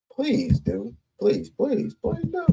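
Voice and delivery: high, soft taunting please do; mocking dare. Real, not acted. Dares critics, then mocks.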